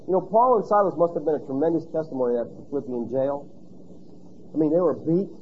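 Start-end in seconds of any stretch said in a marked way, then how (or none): none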